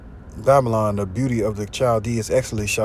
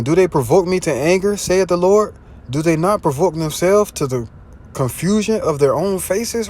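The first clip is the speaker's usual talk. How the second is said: higher, louder preaching read of the verse; scripture preaching; 6 semitones higher, 3 dB louder than usual. Acted. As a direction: proclaim the verse like from a pulpit. Reads scripture aloud, preaching.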